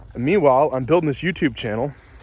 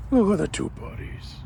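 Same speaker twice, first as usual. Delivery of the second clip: squeaky praising a dog; 8 semitones higher, 4 dB quieter than usual. Real, not acted. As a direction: lift the voice up cooing at the dogs. Second take, higher.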